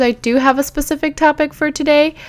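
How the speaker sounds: ordinary talk, nothing marked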